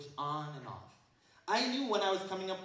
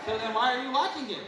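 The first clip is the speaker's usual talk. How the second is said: higher, louder challenge; loud scolding; 7 semitones higher, 11 dB louder than usual. Real, not acted. Preacher scolds students for watching haram shows, loudly. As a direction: call them out hard.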